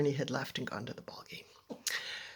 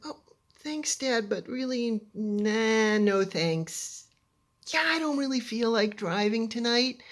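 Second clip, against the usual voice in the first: higher, drawn-out polite demurral; awkward polite refusal; 6 semitones higher than usual. Retold or imitated. Daughter declining dad's offer to drive, awkwardly polite. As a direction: turn the offer down lightly, sparing feelings.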